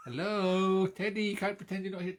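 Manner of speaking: greeting the visiting dog in a high silly voice. Real, not acted. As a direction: coo at the little doggy in a squeaky voice. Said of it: "this silly voice".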